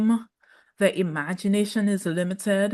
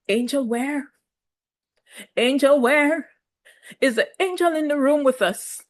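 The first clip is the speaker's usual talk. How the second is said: sceptical, teasing questions, pitched up; mocking disbelief; 5 semitones higher than usual. Real, not acted. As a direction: question it as if searching for proof. Mocks the affirmation she just quoted.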